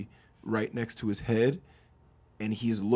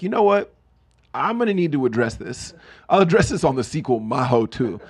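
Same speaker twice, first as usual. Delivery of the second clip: louder, higher, emphatic refusal to apologize; defiant acted rebuttal; 4 semitones higher, 6 dB louder than usual. Rapper answering the person who confronted him, defiant. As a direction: play it indignant and unapologetic.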